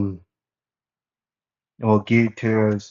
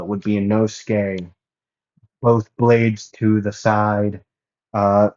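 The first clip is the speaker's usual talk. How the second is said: ordinary delivery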